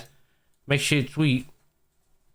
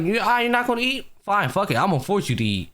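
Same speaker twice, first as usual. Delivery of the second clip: raised pitch, pushy and impatient; a bossy girl losing patience, voiced higher; voicing the girl; 7 semitones higher than usual. Old girl voiced forcing him.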